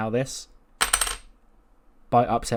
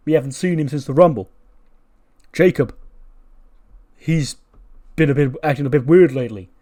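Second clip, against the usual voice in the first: raised, emphatic hype delivery; wrestling tag-team promo; 3 semitones higher, 7 dB louder than usual. Acted. Usos hyping their return before the match, loud and declamatory. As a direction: punch each phrase like a wrestler's promo.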